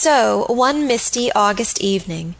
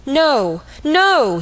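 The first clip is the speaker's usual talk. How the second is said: vehement cry; much slower than usual. A narrator's reading. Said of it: "he cried vehemently".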